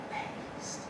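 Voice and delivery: a soft, almost silent repeat of Amazed; whispered echo. Real, not acted. Stunned after the lunch.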